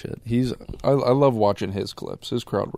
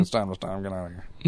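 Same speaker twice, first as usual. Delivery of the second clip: lightly mumbled comedian impression, softer at the end; Adam Sandler; 5 dB quieter, faster than usual. Podcaster imitating Adam Sandler ending a failing set, light impression. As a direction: let the impersonation tail off quietly. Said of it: "Adam just coming in and being like".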